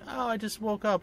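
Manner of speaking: high sleepy pampered dog voice. Retold or imitated. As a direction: voice a lazy baby dog just waking. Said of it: "she's like".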